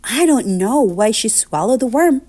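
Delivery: usual speaking voice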